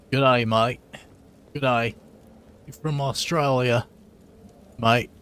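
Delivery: put-on Aussie greeting; mock Australian accent. Player mimicking a game character, mock Australian accent. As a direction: play at being Australian. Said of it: "her accent is not from Australia".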